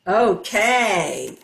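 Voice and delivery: ordinary talk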